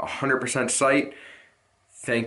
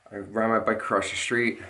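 ordinary talk

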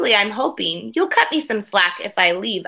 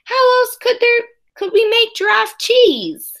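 much higher, pleading comeback; high-pitched retort; 11 semitones higher than usual. Acted. Boy counters teacher with high squeaky voice. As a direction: kid answers teacher in a high squeaky protest. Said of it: "I countered".

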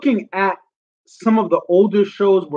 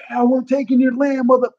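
blunt, higher mock taunt: Yeah, we're taking your land; mock aggressive; 8 semitones higher than usual. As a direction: throw it in their face, half joking. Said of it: "It shouldn't be at the beginning".

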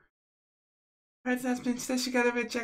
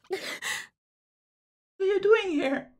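higher, louder shocked demand; shocked question; 7 semitones higher, 4 dB louder than usual. Acted. To Kurisu in the doorway, a loud shocked question. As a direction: blurt it out in disbelief.

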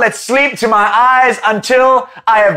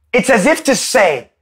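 normal talking voice

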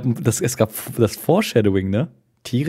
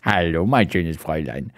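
low, softer put-on voice; mimicking a suave tomcat; 9 semitones lower, 4 dB quieter than usual. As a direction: parrot the greeting in a deep voice. Mimics the story's tomcat greeting. (tiefe, verstellte Katerstimme)

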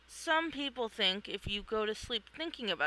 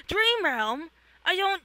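high, louder puzzled outburst; startled confusion; 8 semitones higher, 6 dB louder than usual. Acted. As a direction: react in alarmed disbelief. Manic startled.

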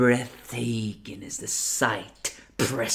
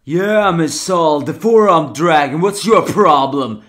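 loud, higher mocking challenge; 5 semitones higher, 9 dB louder than usual. Challenger loudly calls out the four-armed dragon. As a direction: call out the dragon.

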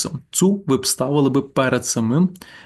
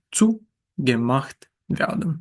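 "Zugemacht" slowly, stressed on "Zu"; much slower than usual. Shown as a demonstration. Teacher modelling the passive for learners, slow "Zugemacht" with stress on "Zu". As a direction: spell out "Zugemacht werden" very slowly, leaning on "Zu". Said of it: "Наголос ставимо на цу".

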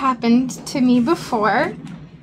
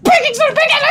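much higher, frantic repeated cry; retold frantic panic; 12 semitones higher, 3 dB louder, much faster than usual. Rummaging for baking soda while the stove burns, panicking. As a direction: call it out, searching the cabinet in a panic. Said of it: "And I'm like".

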